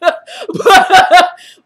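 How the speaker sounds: big, high burst of laughter; loud high laugh. Real, not acted. Laughing at her own comeback to her friend, a loud burst. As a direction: crack up at your own joke.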